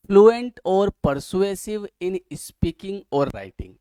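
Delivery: very slow, loud, word-by-word dictation. Acted. Teacher dictating definitions to students, slow and loud. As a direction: spell it out slowly so students can write.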